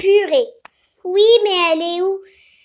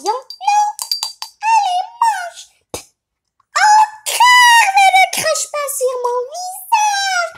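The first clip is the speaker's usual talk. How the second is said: much higher, louder pony doll voice; Pinkie Pie doll voice; 13 semitones higher, 7 dB louder than usual. Pretend. Pony doll feeding the baby, high pony voice. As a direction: play the pony doll, pitched way up.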